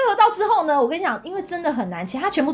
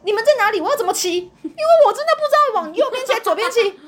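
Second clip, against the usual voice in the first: frantic calling; 9 semitones higher than usual. Retold or imitated. Calling lost on bike.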